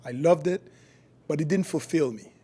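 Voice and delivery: ordinary talk, nothing marked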